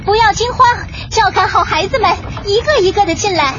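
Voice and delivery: high-pitched urgent marshalling call; mother hen shouting orders; loud shout. Acted. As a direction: call everyone in amid danger. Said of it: "卡梅拉大喊道".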